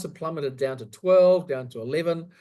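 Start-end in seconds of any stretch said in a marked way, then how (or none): none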